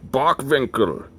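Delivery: Bockwinkel in a German accent, W as V. Shown as a demonstration. If he was in Germany still, German-accented name. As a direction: say it as a German would. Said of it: "he would probably pronounce it".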